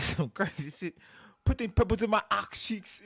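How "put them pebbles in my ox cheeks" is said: high, loud rapper imitation; mock UK rapper. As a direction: mimic his bars, pitched up and loud. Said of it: "What he said".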